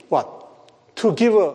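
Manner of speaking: ordinary talk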